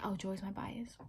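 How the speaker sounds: soft unvoiced aside; whisper. Realizing her favorite member, whispered to herself. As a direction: breathe it as a quiet realization.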